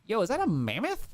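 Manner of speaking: usual speaking voice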